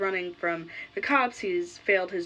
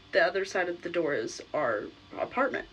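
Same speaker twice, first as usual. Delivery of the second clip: ordinary talk, nothing marked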